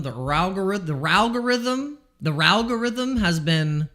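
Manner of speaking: "ralgorithm" said with a playful extra R at the start; mock mispronunciation. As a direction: twist "ralgorithm" into a teasing R sound. Mocking the algorithm.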